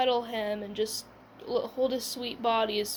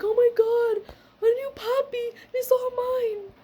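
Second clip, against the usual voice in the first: much higher, loud squeal of joy; excited squeal; 12 semitones higher, 5 dB louder than usual. Retold or imitated. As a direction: squeal like a kid given a puppy. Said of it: "freaking out".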